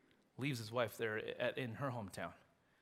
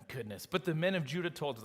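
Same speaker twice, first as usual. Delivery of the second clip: normal talking voice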